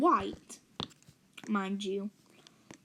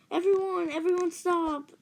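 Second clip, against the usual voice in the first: high, raised mock call; louder, pitched-up order to the room; calling a halt; 7 semitones higher, 4 dB louder than usual. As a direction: imitate calling out to halt a party. Babysitter halting the party, retold.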